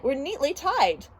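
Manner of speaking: usual speaking voice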